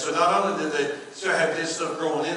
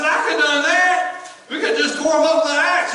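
much higher and louder, a laugh breaking through; exasperated shout; 12 semitones higher, 10 dB louder than usual. Retold or imitated. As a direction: yell it half laughing in disbelief. Pastor exasperated at cut bushes.

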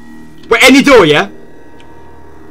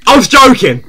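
raised, higher-pitched insistence; loud protest; 4 semitones higher, 4 dB louder than usual. Real, not acted. Scared player insisting it was a joke, loud. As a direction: call it out as a protest. Same words shouted out.

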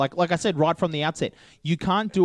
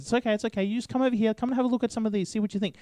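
higher, gentle reassuring saleswoman voice; calm a nervous customer, lightly voiced; soothing shop lady; 8 semitones higher, faster than usual. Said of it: "totally calms him down".

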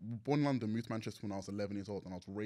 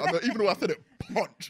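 higher and louder, laughing it off; 10 semitones higher, 11 dB louder than usual. Real, not acted. Laughing after saying he would punch a friend. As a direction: crack up at your own words. Laughing at his own violent example.

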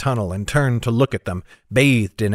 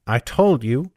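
ordinary delivery

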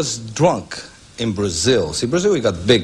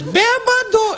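very high squeaky taunting call of bêbado; shrill-pitched mocking snake calling out; slower than usual.

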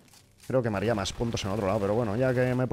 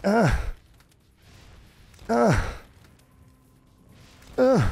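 loud short yells, climbing higher each time; frustrated yells; 10 semitones higher, 4 dB louder, much slower than usual. Real, not acted. Gamer getting beaten, yelling louder and higher each time.

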